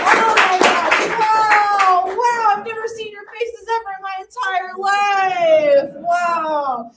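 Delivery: high, loud excited greeting. Real, not acted. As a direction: burst on stage thrilled. Comic greets crowd.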